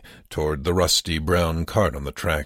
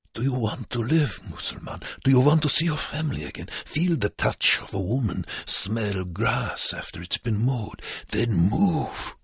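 put-on higher-pitched, accented pleading pressure; urgent goading; 6 semitones higher than usual. A narrator's reading. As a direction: push a dying man to get moving. Wernicke urges prisoner to live.

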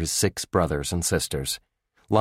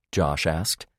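ordinary delivery

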